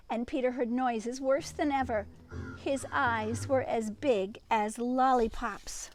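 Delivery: usual speaking voice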